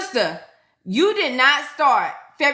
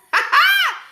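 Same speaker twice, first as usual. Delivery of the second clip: very high, loud laugh; real squealing laugh at a viewer's joke; 5 dB louder than usual. Real, not acted.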